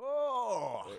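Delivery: sudden loud high "Oh!"; football shout. Shown as a demonstration. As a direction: yell like a missed goal. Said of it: "if you hear one of us go".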